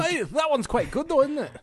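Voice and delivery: high, loud put-on Scottish voice; Scottish accent. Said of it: "You're pretty good at that accent".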